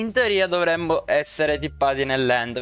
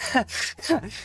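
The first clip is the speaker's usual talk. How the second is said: panicked laugh; 8 semitones higher, 4 dB quieter than usual. Real, not acted. Laughs while being chased.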